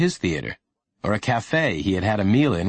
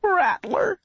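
put-on high, thin child voice; high-pitched and pained; weak hurt boy. A narrator's reading. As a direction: answer feebly as an injured boy. Injured boy names the snake.

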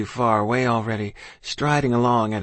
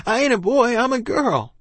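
put-on very high, louder desperate child; crying out; 12 semitones higher, 4 dB louder than usual. A narrator's reading. Child to officer taking her away, desperate cry. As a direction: blurt it high to save yourself. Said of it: "I cried".

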